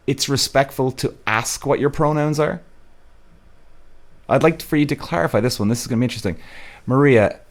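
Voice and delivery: normal talking voice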